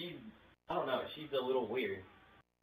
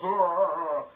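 taunting; 3 semitones higher, 7 dB louder than usual. Real, not acted. Taunting the employee on phone.

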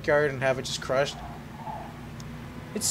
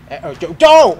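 very loud, higher panicked yelp; alarmed shout; 4 semitones higher, 14 dB louder, slower than usual. Real, not acted. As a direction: yell the name in sudden alarm. Alarmed cry while crashing.

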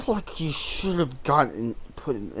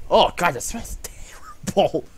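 whispered in disgust; hushed, repulsed whisper; 6 semitones higher, 4 dB louder than usual. Real, not acted. Reacting to the smell, disgusted.

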